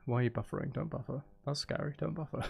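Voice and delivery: usual speaking voice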